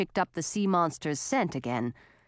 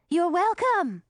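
tiny worm voice; 8 semitones higher, slower than usual. Acted. Worms answer together.

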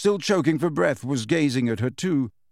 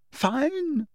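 very high tearful outburst; sobbing; 13 semitones higher than usual. A narrator's reading. Hermione giving in to Umbridge, sobbing. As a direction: wail it in defeat. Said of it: "now sobbing into her hands again".

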